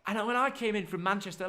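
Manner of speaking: normal talking voice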